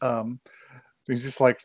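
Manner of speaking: ordinary talk, nothing marked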